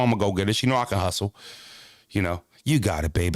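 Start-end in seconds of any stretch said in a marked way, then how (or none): 2.1-3.4 low, soothing wife voice, sweet on the surface; wife's sweet version. Retold or imitated. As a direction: gently reassure him. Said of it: "That's what she meant".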